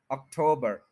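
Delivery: October as ok-TOH-bur, weight on TOH; middle-syllable stress. Shown as a demonstration. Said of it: "middle वाले पे stress है". (अक्टूबर में "टो" पर ज़ोर)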